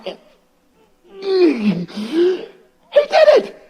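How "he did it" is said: loud, much higher burst of joy; excited storyteller climax; triumphant. A narrator's reading. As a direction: celebrate the win out loud.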